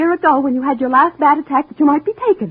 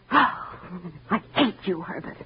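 sighing hiss of hate; 4 semitones lower, 10 dB quieter, much slower than usual. Acted. Wife confesses her hatred.

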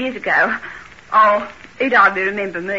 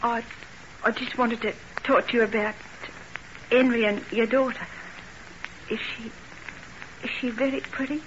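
soft, halting curiosity; quietly wistful, dropping softer as the question forms; hesitant asking; 9 dB quieter than usual. Acted. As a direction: ask tentatively, with awkward pauses. Shyly asking about sweetheart's fiancée.

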